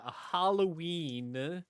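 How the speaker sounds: slow, drawn-out Halloween with a rise; Halloween stretched and lifted at the end; stress on Halloween. Shown as a demonstration. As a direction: lean into Halloween slowly. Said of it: "emphasis on Halloween".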